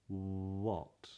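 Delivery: "What" with a long oo-like W at the start; rounded W. Shown as a demonstration. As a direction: linger on rounded lips, then open into "What". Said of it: "try that in these words".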